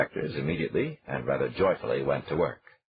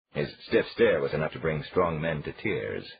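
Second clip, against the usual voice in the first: plain reading voice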